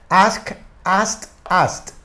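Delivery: "Ask" with final k, then "asked" as "ast"; ED as T drill. Shown as a demonstration. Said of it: "terminado en T".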